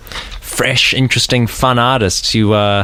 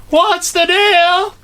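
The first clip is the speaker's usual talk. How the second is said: very high, slow comic Seinfeld voice; hammed-up Seinfeld bit; Seinfeld impression; much slower than usual. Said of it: "So this is [name] Seinfeld".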